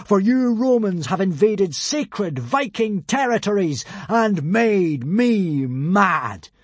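slow, high god-like bellow; grand, drawn-out angry boom. A narrator's reading. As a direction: declaim your wrath, stretching the last words. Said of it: "bellowed Hiccup".

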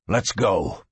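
low growled order. A narrator's reading. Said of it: "he growled".

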